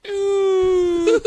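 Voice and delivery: long, loud high-pitched "ooh"; high whoop. Real, not acted. Host smelling the strong beer, high whooping.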